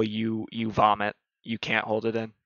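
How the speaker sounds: ordinary talk, nothing marked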